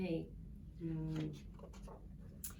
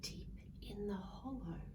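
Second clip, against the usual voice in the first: much softer whispered narration; 8 dB quieter than usual. A narrator's reading. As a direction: whisper the stillness. Hush falls in hollow.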